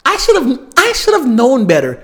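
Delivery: high, loud exasperated outburst; worked-up, high-pitched self-reproach; exasperated regret. Real, not acted. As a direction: kick yourself loudly, pitch way up. Regretful outburst over the show.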